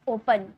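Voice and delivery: ओपन with a light, unstressed पन; unstressed final syllable. Shown as a demonstration. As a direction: let the पन of ओपन drop away. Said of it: "इसमें आप जोड़ नहीं दोगे". (ओपन, पन पर ज़ोर दिए बिना)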